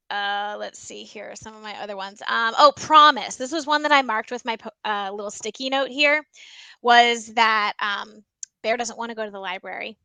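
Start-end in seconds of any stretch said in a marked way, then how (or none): none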